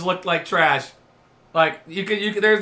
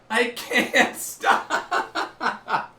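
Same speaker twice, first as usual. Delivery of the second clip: very slow, breathy words through laughter; can't finish for laughing; 5 semitones higher, much slower than usual. Real, not acted. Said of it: "I can't stop laughing".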